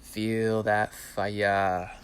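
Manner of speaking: very slow, drawn-out instruction; slow guided cue. Shown as a demonstration. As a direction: stretch each word like a breath coach. Guiding breathwork, slow cue.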